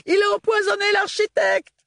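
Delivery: shouting. Acted. Crowd accuses Ophélie.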